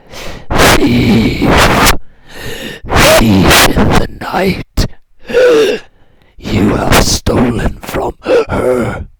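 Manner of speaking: loud, slow, ghostly roar of the dead; booming corpse voice. Acted. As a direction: bellow it slowly like a vengeful corpse. Corpse accuses the thief.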